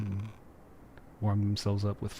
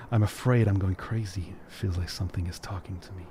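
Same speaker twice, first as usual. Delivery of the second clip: put-on lightly performed reading of an old letter; theatrical reading of a love letter; dramatic letter reading; 3 semitones lower than usual. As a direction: read it out like a heartfelt note. Reads a found letter aloud.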